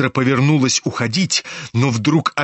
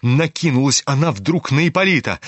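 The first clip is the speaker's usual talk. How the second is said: ordinary delivery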